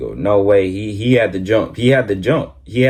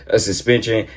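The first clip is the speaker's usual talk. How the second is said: ordinary delivery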